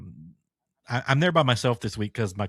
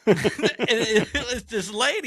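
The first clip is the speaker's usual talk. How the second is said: laughing; 12 semitones higher, 3 dB louder than usual. Real, not acted. Laughing while telling.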